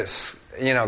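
normal talking voice

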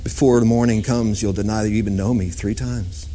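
low, gentle voice of Jesus; a lightly voiced Jesus softly warning Peter. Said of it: "And Jesus said".

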